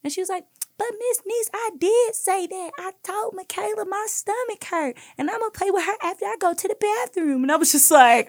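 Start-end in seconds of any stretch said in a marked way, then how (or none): 0.8-7.4 put-on very high childish protest; little girl's voice. Callie insists to her teacher she already explained, very high little-girl voice. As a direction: squeak it out like an indignant preschooler.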